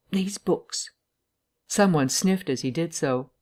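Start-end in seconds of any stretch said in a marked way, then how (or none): none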